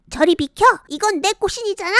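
put-on high, fast snippy girl; bossy Patjwi. Patjwi pushing a girl aside, high fast bossy voice. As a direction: shove in and claim it.

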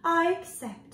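high, delighted little spider voice; joyful delight. A narrator's reading. Spider accepts the invitation, with delight. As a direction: accept with glee. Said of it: "with joy and delight".

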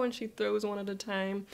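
normal talking voice

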